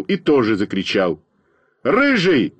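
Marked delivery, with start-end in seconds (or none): 1.8-2.5 high, short shout at a classmate; lightly acted angry yell; mock yell. A narrator's reading. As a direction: call out sharply, higher in pitch. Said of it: "закричал я на Веньку Смирнова".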